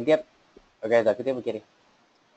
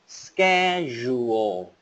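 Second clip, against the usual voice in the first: schedule stretched slowly from the mouth; slow model word; much slower than usual. Shown as a demonstration. Teacher showing mouth shape on schedule, slow. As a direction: pull schedule out slowly, sound by sound. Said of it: "Khỏi miệng này".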